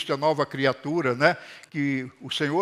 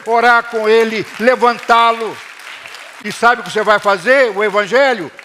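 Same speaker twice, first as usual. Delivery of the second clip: loud, high rallying call; full-force preaching; 5 semitones higher, 6 dB louder than usual. Acted. Pastor urging members to strengthen each other, loud rallying preaching. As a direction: lift them up with every phrase.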